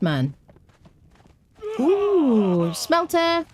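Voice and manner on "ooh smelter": excited. Real, not acted. Excited find of a smelter.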